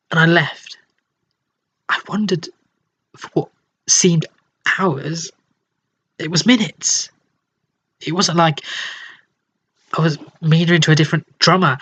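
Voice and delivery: normal talking voice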